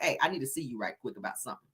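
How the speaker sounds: quick and soft; hushed coded request. Retold or imitated. A mom whispering code to her husband, hushed and quick. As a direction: slip it past the kids quietly. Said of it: "you have to talk in code".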